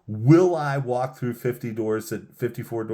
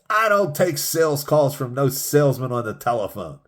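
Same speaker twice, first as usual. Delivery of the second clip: retold yell; 5 semitones higher, 5 dB louder than usual. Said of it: "he starts screaming at me".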